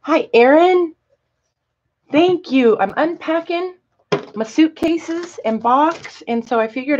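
high bright greeting; cheery greeting. Real, not acted. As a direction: sing out a hello. Greeting a viewer.

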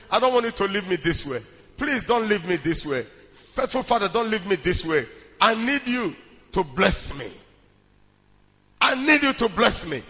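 ordinary delivery

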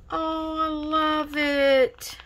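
slow, high delighted coo. Real, not acted. Delighted by stickers.

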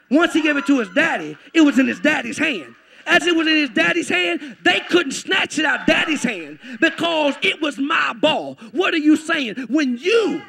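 high, shouted sermon climax; preaching at full force. Real, not acted. Preacher tells believers they are safe in Father's hands, shouting. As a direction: pound out each phrase to rouse the congregation.

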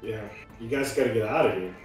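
usual speaking voice

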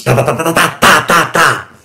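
loud, high rattle of shots; rapid-fire vocal gunshots; gunfire imitation. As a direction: fire off a burst like a machine gun.

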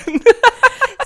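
high, loud burst of laughter. Real, not acted. Laughing at the slip of words.

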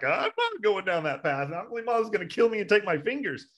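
high-pitched mock protest; hammed-up frightened monk, pitched high; scared refusal. Monks refusing to follow the Buddha down the path, mock fear.

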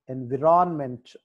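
Environment with the stress on "vi-ron"; environment, stressed in the middle, falling away. Shown as a demonstration. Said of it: "వి దగ్గర".

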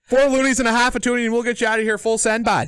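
put-on high, loud Canadian ad-pitch accent. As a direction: close the Canadian deal, bud. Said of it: "with my Canadian voice the whole time".

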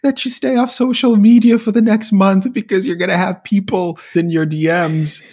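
hammed-up scolding over the Brooklyn Bridge; mock indignant scolding. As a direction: scold him like a scandalised aristocrat.

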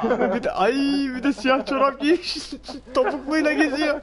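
squeaky high laughter over every word; breaking up with laughter mid-sentence; laughing. Real, not acted. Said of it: "laughing at costume".

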